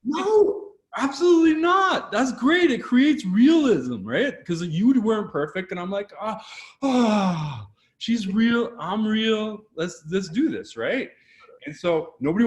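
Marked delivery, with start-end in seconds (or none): 0.0-2.7 high, loud, emphatic no; excited. Real, not acted. Excited denial to cohost about mistakes. 6.2-10.8 excited quote. Retold or imitated. Imitated own excited reaction.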